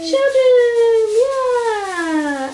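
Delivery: loud high excited cheer. Real, not acted. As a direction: call it out with delight. Excited at children page.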